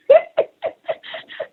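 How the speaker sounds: a high airy giggle; breathy high laugh. Real, not acted. Laughing with the host about the towels in her car. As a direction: let out a light breathless laugh.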